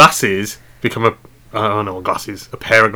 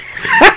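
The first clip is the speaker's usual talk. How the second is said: high squeal laugh. Real, not acted. Bursts out laughing.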